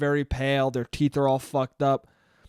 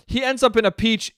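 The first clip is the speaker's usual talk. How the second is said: normal talking voice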